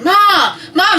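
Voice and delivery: high, loud call for Mom. Retold or imitated. Daughter runs inside to tell mom, calling out. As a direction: holler to fetch someone.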